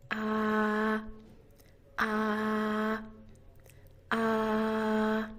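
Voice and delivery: "Ah" held long and even, mouth wide; open ah vowel. Shown as a demonstration. Teacher modeling the most open vowel, wide ah. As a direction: drop the jaw and sustain "Ah". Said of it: "said with the mouth open".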